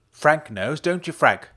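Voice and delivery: high, loud challenge; firm. A narrator's reading. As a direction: press the point insistently. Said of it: "Rose repeated firmly".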